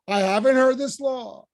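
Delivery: owning it without shame; rhetorical, unbashful. Shown as a demonstration. Teacher voicing Moses, confident and unbashful. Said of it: "That's rhetorical".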